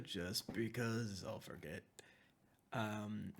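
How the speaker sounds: ordinary talk, nothing marked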